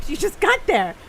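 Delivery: high-pitched retold disbelief; incredulous, reenacted reaction; surprised question. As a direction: question it in astonishment, pitch jumping up. Retold disbelief at friend leaving already.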